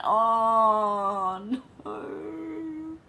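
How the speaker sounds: high, loud dismayed cry; shocked oh no. Real, not acted. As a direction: recoil in horror. Reacting to fish eyes.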